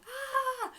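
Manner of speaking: high-pitched drawn-out "aah"; loud, high power scream, acted out in retelling; imitated battle scream. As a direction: let out a high mock scream. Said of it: "I just went".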